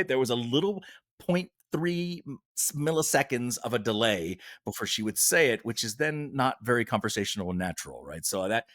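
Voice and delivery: usual speaking voice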